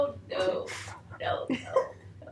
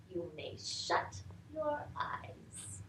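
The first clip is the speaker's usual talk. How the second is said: soft slow whisper; hushed; 8 semitones lower, 18 dB quieter than usual. Acted. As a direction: soothe her toward sleep. Hushed soothing.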